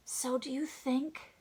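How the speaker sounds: ordinary delivery, nothing marked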